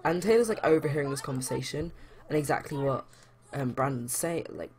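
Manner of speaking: ordinary talk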